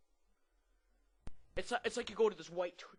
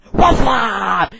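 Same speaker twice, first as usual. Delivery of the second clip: huge, high, drawn-out mock scream; screamed greeting; 7 semitones higher, 28 dB louder than usual. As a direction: scream it into the phone like the ad. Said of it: "the guy screams in the phone".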